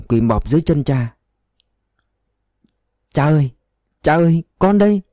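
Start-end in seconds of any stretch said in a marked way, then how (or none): 3.1-5.0 anguished cry to father. A narrator's reading. Son kneeling at his father's feet, calling out anguished. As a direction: cry out to the father you abandoned.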